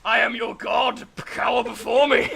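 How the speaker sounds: booming villain roar; dragon voice. Acted. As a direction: gloat like a mighty beast. Cyclones win, gloating villain.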